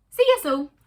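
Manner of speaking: cheerful shout. Acted. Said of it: "said Paddy".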